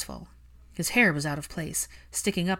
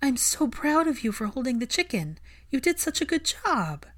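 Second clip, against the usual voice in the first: put-on high, gentle praise for a child; encouraging a child, lifted high; tender praise; 7 semitones higher than usual.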